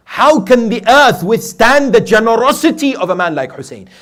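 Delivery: high, loud rhetorical cry; impassioned preaching. Real, not acted. Preacher marvels at Hussein's generosity to congregation, impassioned preaching. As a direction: throw the question out in awe at full force.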